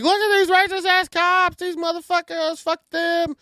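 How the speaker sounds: high, loud angry jeering at the cops; jeering crowd. Retold or imitated. Bystanders heckling the officers on video, mock angry shout. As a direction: sneer it out like an outraged bystander. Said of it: "they're like".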